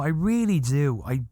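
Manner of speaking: ordinary talk, nothing marked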